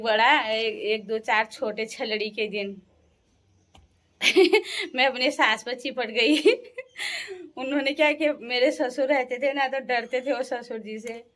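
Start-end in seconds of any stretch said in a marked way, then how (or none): 4.2-4.9 high, loud burst of laughter; amused laugh. Real, not acted. Telling how she clung to mother-in-law, amused laugh. Amused by her own story. 6.5-7.5 high-pitched giggle; amused laugh. Real, not acted. Recalling clinging to her mother-in-law, high amused giggle.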